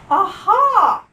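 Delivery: loud crying aha; a dog delighted with itself; gleeful aha. Acted. Dog spots the frog, loud gleeful cry. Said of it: "thinks the dog".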